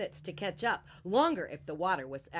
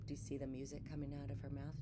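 ordinary delivery

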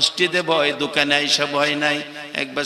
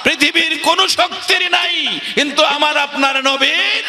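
high, fast climactic sermon cry; rousing preacher at full force; fervent preaching; 13 semitones higher, 5 dB louder than usual. Real, not acted. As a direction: hurl the answer out at a high pitch.

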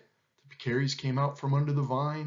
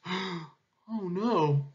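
higher, breath-led sigh of dismay; 5 semitones higher than usual. A narrator's reading. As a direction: sigh the words as it sinks in. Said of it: "sighed the monkeys".

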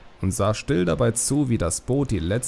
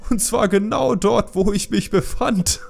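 much higher, laughing through the punchline; roaring laughter; 12 semitones higher, 4 dB louder than usual. A narrator's reading. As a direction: burst out laughing at your own joke. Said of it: "Er brach in schallendes Gelächter aus".

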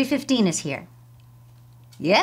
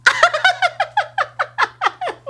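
high, long burst of laughter; hysterical laugh; 5 dB louder than usual. Acted. Receptionist hearing applicant's plain name, hysterical laughter. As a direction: burst out in loud, shrill-pitched giggles.

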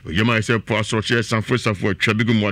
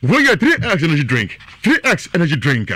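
loud, high brand call; high, loud energy-drink shout; hyped ad slogan; 6 semitones higher, 4 dB louder than usual. Acted. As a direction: sell it like a radio jingle. Ad jingle shout.

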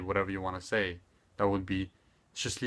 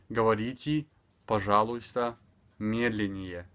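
"Говорите, пожалуйста, медленнее" carefully word by word; measured model phrase with short gaps; slower for learners. Shown as a demonstration. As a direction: give each word its own space. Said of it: "speaking extremely slower".

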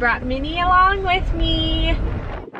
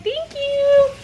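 voicing the dog; 10 semitones higher than usual. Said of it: "She's like".

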